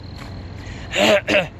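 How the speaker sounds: food down the wrong pipe cough; hacking cough on a crumb; choking cough. Real, not acted. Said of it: "Piece of hamburger went down the wrong pipe".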